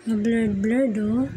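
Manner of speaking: normal talking voice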